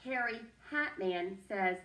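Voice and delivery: usual speaking voice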